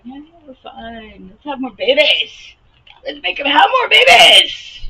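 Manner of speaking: shrill mocking people who breed; building to a mock shout. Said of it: "fucking people are just like".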